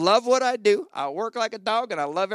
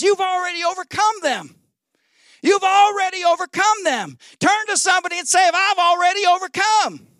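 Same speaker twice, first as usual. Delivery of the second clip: high, loud preacher's proclamation; preaching at full force; 13 semitones higher, 8 dB louder than usual. Acted. Preacher urging the congregation to declare victory, preaching at full force. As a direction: proclaim it triumphantly to the crowd.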